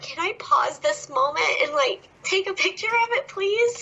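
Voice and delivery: high, dreamy plea; hushed high wishful plea to God; pleading inner voice. Retold or imitated. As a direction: beg the moment to freeze, breathlessly high. Wistful inner plea retold.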